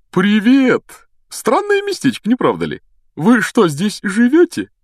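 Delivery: high, blithe greeting; cheerfully oblivious man. Acted. Amnesiac professor greeting strangers in a strange place, blithely cheerful. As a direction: chirp it with clueless good cheer. Voices Lockhart after memory loss.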